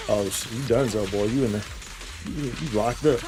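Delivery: usual speaking voice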